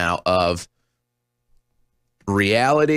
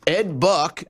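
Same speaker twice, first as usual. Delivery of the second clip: usual speaking voice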